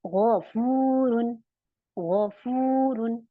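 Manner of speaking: गफूरुन very slow, as throaty gho-fooo-run; heavy ghain. Shown as a demonstration. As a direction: chant गफूरुन with thick gh and long oo. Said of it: "मुझे इसे heavy बनाना है गौइन को".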